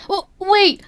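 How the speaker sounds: high, loud cry to stop him; boy stops the demon with a high, loud cry; desperate call. Acted. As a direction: shout it out as he grabs the arm.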